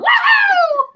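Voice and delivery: high, loud mock cheer; mock celebration. Cheering a list of pet topics, mock celebration. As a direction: whoop it up in exaggerated triumph.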